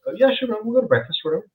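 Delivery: high, easygoing mock reply; breezy agreement. Comic retelling his own casual yes to his friend. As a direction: toss off the plans like no big deal.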